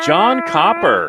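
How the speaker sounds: long, high, loud shout of the name; hyped announcer call. Real, not acted. Announcer hailing the bagpipe king of Tough Mudder, hyped call. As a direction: belt out the name like a ring announcer.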